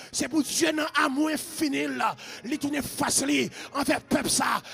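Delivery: loud, driving deliverance prayer; full-force preaching. Real, not acted. Preacher commands spirits in Jesus' name, full-force shouted preaching. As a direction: hammer out each repeated phrase at shouting force, stretching the holy name.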